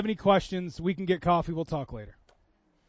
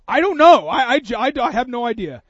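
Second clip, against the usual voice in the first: flustered; 5 semitones higher, 12 dB louder than usual. Retold or imitated. Flustered reply in cereal aisle.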